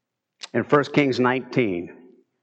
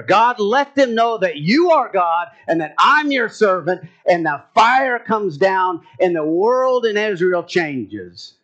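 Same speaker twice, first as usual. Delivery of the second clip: fervent preaching; 4 semitones higher, 4 dB louder than usual. Acted. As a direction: lift the prayer like a fervent cry. Fervent climax of prayer story.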